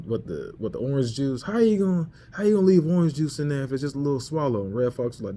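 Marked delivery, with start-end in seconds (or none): 1.4-4.6 nagging. Retold or imitated. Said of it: "was nagging about the".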